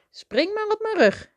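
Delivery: very high, lifted story-character voice; eager offer. A narrator's reading. Rabbit offering Toby a ride home, high eager offer. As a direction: sing out a cheerful invitation.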